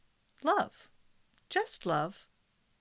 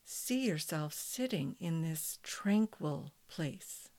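guided meditation; 5 dB quieter than usual. Acted. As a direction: guide them gently into calm.

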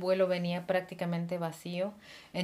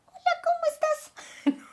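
very high and slow, squeaky; the nervous squeaky hello her husband heard; 3 dB louder, slower than usual. Shown as a demonstration. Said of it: "que sonaba así de".

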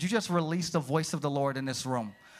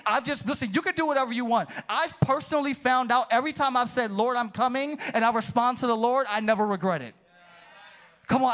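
preaching; 5 semitones higher than usual. Acted. To the church, preaching at full force. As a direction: preach it with rising fire.

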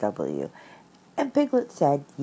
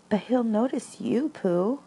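high, small anxious voice; little piglet fretting to his friend; worried Piglet; 8 semitones higher than usual. Acted. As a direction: fret in a tiny high voice.

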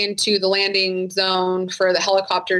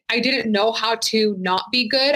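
usual speaking voice

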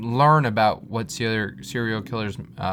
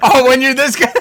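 mocking; 13 semitones higher, 10 dB louder than usual. Mocking friend's boast.

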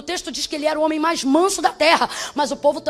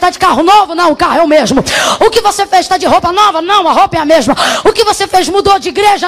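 loud, shouting sermon delivery; preaching at full force; 4 semitones higher, 11 dB louder than usual. Acted. Preacher shouting over the music to the congregation, full-force preaching. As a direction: preach it out, voice raised to the rafters.

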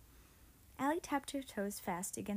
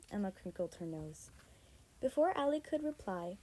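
plain reading voice

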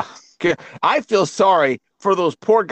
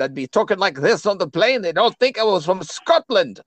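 put-on Scottish accent with a lilt; 3 semitones higher than usual. Shown as a demonstration. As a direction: talk like a guy from Scotland. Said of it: "a guy from Scotland".

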